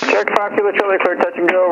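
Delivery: ordinary talk, nothing marked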